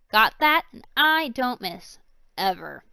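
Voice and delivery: loud menacing warning; threatening. Acted. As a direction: warn them, slowly. Villain threatens prisoners.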